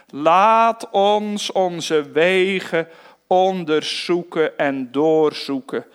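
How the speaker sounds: slow, stretched "Laat ons onze wegen onderzoeken"; slow emphatic quote. Shown as a demonstration. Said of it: "er staan twee woorden". (langzaam en nadrukkelijk)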